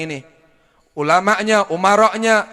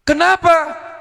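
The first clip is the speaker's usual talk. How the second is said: put-on pleading child; 10 semitones higher, 3 dB louder, much slower than usual. Boy protests mother.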